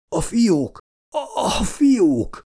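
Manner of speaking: high-pitched halting plea, slowing as it repeats; stricken groaning. A narrator's reading. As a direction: strain out the words as if collapsing. Minister collapsing, begging for medicine.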